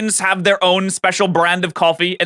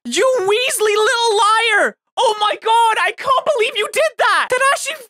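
outraged; 12 semitones higher than usual. Real, not acted. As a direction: call out a betrayal in disbelief. Outraged at covered-up challenge.

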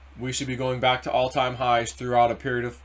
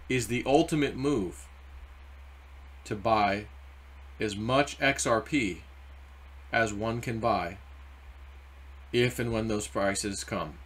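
slow emphasis; 5 dB quieter, slower than usual. Real, not acted. Emphasizing slowly.

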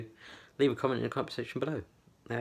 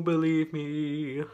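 drawn-out, slow and theatrical; dramatic slow voice; 6 semitones higher, 4 dB louder, much slower than usual. Pretend. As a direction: stretch it out like a ham actor. Plain then dramatic repeat.

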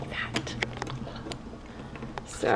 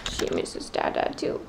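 whisper; 9 dB quieter than usual. Real, not acted. Whispered aside.

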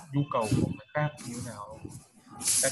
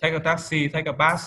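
loud model phrase; 4 semitones higher, 8 dB louder than usual. Shown as a demonstration. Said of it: "nói rồi đúng không".

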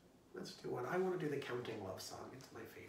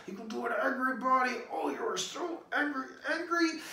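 hammed-up angry growl, much higher and louder; mock angry; 10 semitones higher, 11 dB louder than usual. Shown as a demonstration. Teacher showing kids an angry body, hammed-up mock anger. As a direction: play a furious kid for fun. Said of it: "Angry face, make it really mad".

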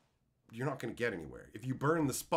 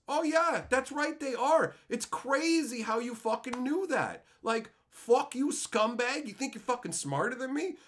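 sarcastic outrage; 8 semitones higher than usual. Retold or imitated. Trader telling off a caught scammer, sarcastic outrage. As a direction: act out scornful triumph, pitched way up.

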